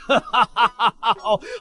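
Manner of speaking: staccato evil cackle; villain's laugh. Acted. Cornered old schemer before his threat, villain's laugh.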